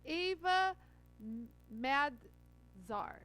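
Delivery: Ava M-, Madzar sounded out syllable by syllable, very slow; slow careful spelling-out. Shown as a demonstration. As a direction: drag out Madzar to get it right. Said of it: "I'm gonna tell you her name".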